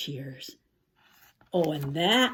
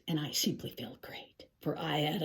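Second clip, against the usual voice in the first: quiet whispered glee; 4 semitones lower, 7 dB quieter than usual. A narrator's reading. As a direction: whisper it like a happy secret. Excited secret near the ending.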